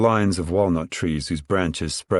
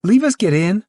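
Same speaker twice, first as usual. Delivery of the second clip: put-on high, louder child's plea; crying out; 11 semitones higher, 6 dB louder than usual. A narrator's reading. Ruthie begs the children to join, shouting high. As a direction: shout to be let into the game. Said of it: "Ruthie cried".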